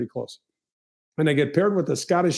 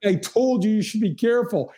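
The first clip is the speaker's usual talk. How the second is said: higher, told-you-so scolding; mock scolding; 7 semitones higher than usual. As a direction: needle the caddy, pitch up. Scolding caddy in retold scene.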